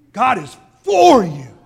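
God is FOR you, slow and loud; stress on for, shouted. Shown as a demonstration. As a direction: shout it, hitting the word for. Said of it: "he emphasizes each word".

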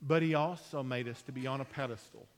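usual speaking voice

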